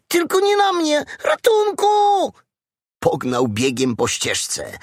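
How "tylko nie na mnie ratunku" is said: very high frightened cry, slowing on help; panicked piglet. A narrator's reading. Piglet begging to be spared by the mosquito, high panic. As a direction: wail for rescue in terror.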